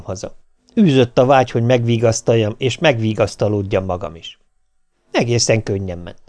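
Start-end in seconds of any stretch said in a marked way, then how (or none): none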